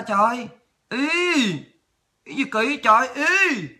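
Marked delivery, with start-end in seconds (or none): none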